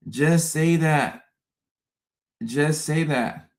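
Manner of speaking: slowed right down, deliberate; slow pleading repeat. Real, not acted. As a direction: drag it out, pleading. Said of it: "repeated slower".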